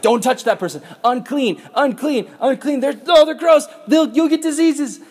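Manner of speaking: high, loud, disgusted shouting; crowd yelling unclean. Retold or imitated. Crowd yells at the leper to stay away, loud and disgusted. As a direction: yell it like a mob warning everyone off. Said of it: "everyone else is like".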